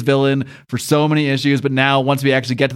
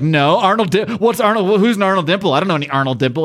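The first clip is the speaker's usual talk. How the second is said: higher, stumbling protest; mock flustered denial; 5 semitones higher than usual. Fiend denying being Arnold Dimple, flustered protest. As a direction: play at hurriedly denying you know the name. Fiend denies the identity, acted.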